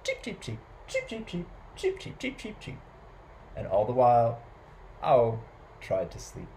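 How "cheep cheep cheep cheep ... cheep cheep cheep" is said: tiny, high, soft cheeping; quick high chirps, very soft; adorable sparrow cheeps. A narrator's reading. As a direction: cheep daintily like a little sparrow. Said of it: "makes adorable little noises".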